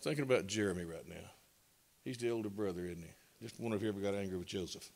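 low, soft aside to the room; quiet aside. Real, not acted. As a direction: drop the voice for a side joke. Aside about the older brother.

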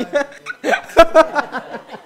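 loud, high laugh at her own joke; a long loud laugh that takes over; real burst of laughter. Real, not acted. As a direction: break up laughing.

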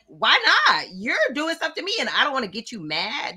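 voicing a victim answering back; indignant retort. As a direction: push back hard, playing the indignant victim. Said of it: "It's like".

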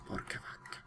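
hushed whispered swear; whispered curse. Real, not acted. Gamer hushing himself after screaming, whispered curse. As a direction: swear under your breath in a whisper. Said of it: "Non devo urlare".

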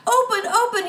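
high, loud pleading cry at the rock; desperate cry. A narrator's reading. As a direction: beg the stone wall to open, loud and high. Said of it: "he cried".

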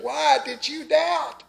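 ordinary talk

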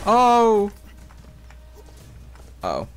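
normal talking voice

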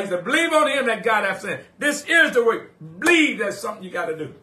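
loud, high, driving preacher's cry; preaching at full force. Acted. Pastor urging the church to believe, full-force preaching. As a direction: preach it out to the congregation.

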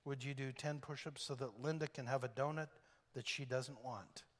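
hushed, heavy ask; quietly asking. Retold or imitated. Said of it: "Professor Christensen quietly says".

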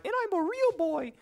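put-on high, soft puppet-boy voice; squeaky put-on little-boy voice; real-boy voice. Quotes Pinocchio as a joke.

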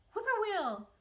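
high, loud whippoorwill call; a bird calling across the road. A narrator's reading. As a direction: sing it out like a bird. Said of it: "a bird sang".

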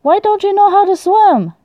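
high-pitched, exasperated complaint. Real, not acted. Exasperated at game kid drowning.

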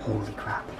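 scared whisper. Real, not acted. Alone at night after the door opened, frightened whisper. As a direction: whisper so nobody in the dark hears.

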